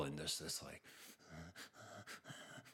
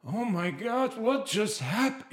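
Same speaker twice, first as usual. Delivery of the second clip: higher, imitating his own shocked panic; retold panic; 11 semitones higher, slower than usual. Recalling himself after the crash, in mild mock panic. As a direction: replay the moment the fear hit, lightly. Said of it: "fear and anxiety".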